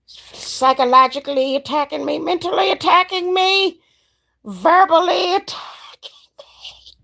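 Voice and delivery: high, whiny complaining client voice, slowing at the end; mock the dramatic client piling on complaints; imitating a client. Mocking clients who complain of attacks, high whiny voice. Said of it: "People be like".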